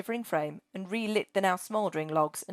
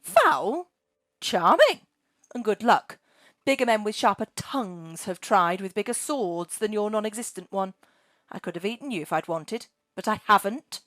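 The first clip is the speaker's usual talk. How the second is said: offended demand; 3 semitones higher, 4 dB louder than usual. Acted. Dragon Margaret offended at being called foul, indignant. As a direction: bristle and demand answers. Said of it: "she demanded".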